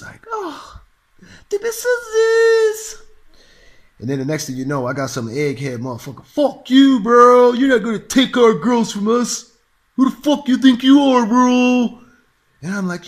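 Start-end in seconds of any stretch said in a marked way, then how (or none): 0.3-3.0 high, loud, drawn-out mock shriek; hammed-up female squeal, slow and piercing; shrieking woman. Woman reacting in retold flirt. 6.3-12.0 loud, higher-pitched macho yelling; hammed-up furious bro shouting; mock angry shout. Egghead guy confronts the speaker.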